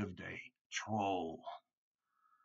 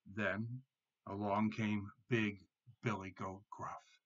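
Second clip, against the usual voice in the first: plain reading voice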